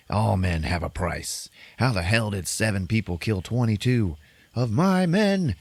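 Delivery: drawn-out mock villain outrage; retold outrage. Villain boss to his men over heavy losses, mock outrage.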